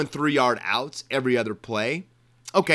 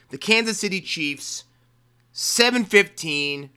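normal talking voice

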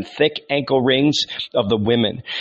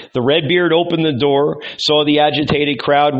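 ordinary delivery, nothing marked

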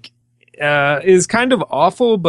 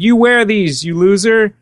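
louder, jeering quote of a heckler; mock heckling; 4 semitones higher, 5 dB louder than usual. Drive-by stranger throwing underwear at the crew, mock heckling. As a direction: toss out the insult loudly, imitating a passerby.

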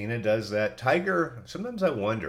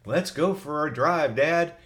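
pleading whine, higher and slowly drawn out; mock whiny voice, pitched up; whiny dog; 6 semitones higher, 4 dB louder than usual. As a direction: lightly whine it like a dog begging for a ride. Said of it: "would be like in a whiny voice".

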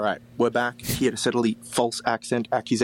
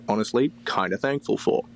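ordinary talk, nothing marked

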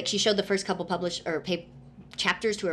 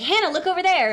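high, loud fake-alarmed pointing out; distracting call; 11 semitones higher, 9 dB louder than usual. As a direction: call out loudly to trick the maid. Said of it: "They're like".

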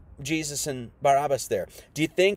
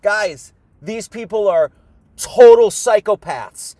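higher and louder, pleading with the crowd; urgent pleading shout; 3 semitones higher, 6 dB louder than usual. Retold or imitated. Barabbas warning the crowd, pleading loud and high. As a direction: cry out that they're psychopaths, much louder. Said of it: "he was probably like".

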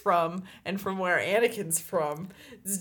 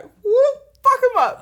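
high, loud mock battle cry; riled-up fans; 11 dB louder than usual. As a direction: whoop like a fan charging into a fight. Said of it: "the shit that they pick up is like".

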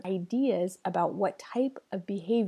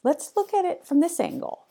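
bright, much higher, louder pitch; upbeat suggestion; 9 semitones higher, 4 dB louder than usual. Shown as a demonstration. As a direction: sell the new angle cheerfully. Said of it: "How about try this?".

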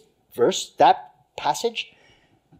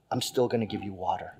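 gentle voice of God; 6 semitones lower, 9 dB quieter than usual. Acted. God's gentle reply.

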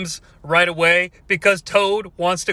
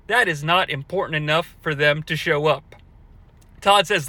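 ordinary talk, nothing marked

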